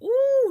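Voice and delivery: very high, drawn-out ooh of exasperation; irritated "ooh". Real, not acted. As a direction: squeal with frustration mid-sentence. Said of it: "that irritates me".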